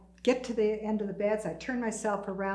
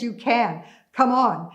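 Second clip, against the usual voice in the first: louder, firm encouragement; urging on; 2 semitones higher, 9 dB louder, slower than usual. Retold or imitated. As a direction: push a hesitant friend to act. Urging a frightened friend.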